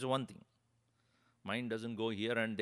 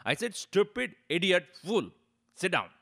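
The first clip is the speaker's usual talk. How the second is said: louder, higher repeat of "Stupid idiot fool"; repeated insult; 6 semitones higher, 8 dB louder than usual. Retold or imitated. Swami repeating the insult to the upset man, louder and firmer. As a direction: fling the insult back firmly. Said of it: "He got so upset".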